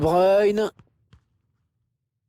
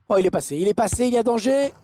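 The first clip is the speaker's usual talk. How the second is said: building to a high excited goal call; excited sports commentary; 10 semitones higher than usual. Real, not acted. Commentateur annonce un but. (commentaire sportif surexcité)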